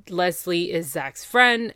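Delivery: ordinary talk, nothing marked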